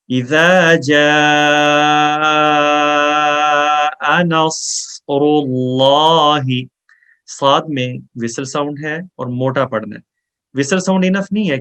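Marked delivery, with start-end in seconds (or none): 0.1-6.4 very slow Quran recitation, vowels held long; drawn-out recitation. Shown as a demonstration. Teacher demonstrating elongation to students, slow chanted recitation. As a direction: stretch each long vowel to a full three beats. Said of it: "اڑائی سے تین الف لمبا کرنا".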